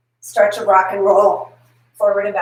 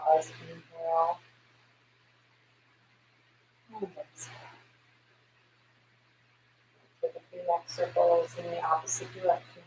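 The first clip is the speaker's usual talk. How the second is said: quiet, unhurried guidance; soft yoga cue; 2 semitones lower, 11 dB quieter than usual. Acted. Guiding after breath round.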